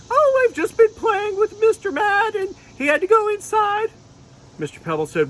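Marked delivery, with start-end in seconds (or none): 0.1-3.9 very high, chatty unicorn; cheerful little unicorn explaining; unicorn puppet voice. Pretend. Unicorn puppet answering the dragon, very high. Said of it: "Sylvia said".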